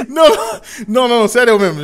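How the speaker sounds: laughing. Real, not acted. Hiccup laughing fit.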